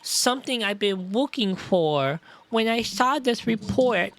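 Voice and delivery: put-on childish voice with r's and l's as w's; small-child voice, confessing; earnest little girl. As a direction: explain haltingly like a small child. Voices the child Iris in game.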